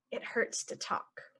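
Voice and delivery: whispered, as if talking hurts. Shown as a demonstration. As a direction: whisper it like a sore throat. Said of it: "normal then whispered".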